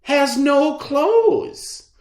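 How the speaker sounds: put-on high child's call, slowing at the end; child calling to his father in the crowd. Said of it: "a young child called out".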